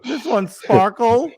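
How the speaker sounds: high, slow, doting cat lady voice; crazy cat owner. Retold or imitated. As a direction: coo it like a cat obsessive. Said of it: "crazy cat owner that's like".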